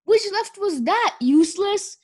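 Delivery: high, loud mocking bellow; a furious trainer bellowing at her clumsy pupil. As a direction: yell the insult right in his ear. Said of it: "Kestrel bellowed in his ear".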